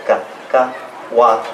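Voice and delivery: Cacahuat broken into ca, ca, huat; real slow. Shown as a demonstration. Teacher breaks Cacahuat down slowly for learners. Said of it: "I'll say it real slow".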